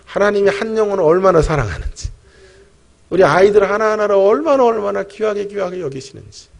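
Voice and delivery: loud, rising preacher's fervor; preaching at full force. Acted. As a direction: build it like a revival sermon climax. Preaching climax.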